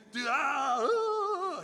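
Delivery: very high, loud sustained wail; hammed-up theatrical incantation; mock big showman. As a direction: stretch it into a huge parody cry. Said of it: "didn't do these big".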